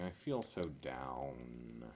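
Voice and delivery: slow, low, mopey voice; a deflated, gloomy sigh of a line; mopey tire. Pretend. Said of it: "Despair Tire saying".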